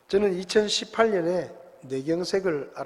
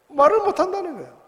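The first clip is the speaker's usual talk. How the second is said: much higher and louder, '말을' hit hard; emphatic repeat; 13 semitones higher, 7 dB louder than usual. Shown as a demonstration. Pastor hammering the point to the congregation, pitched much higher. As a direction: push the repeat up in pitch to drive it home. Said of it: "repeated point, pitched much higher".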